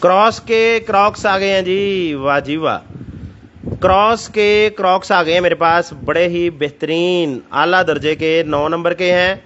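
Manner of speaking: ऊँची, जोशीली sales pitch; overeager teleshopping pitch. Acted. Raised, excited sales pitch. (बढ़ा-चढ़ाकर बेचने वाली आवाज़)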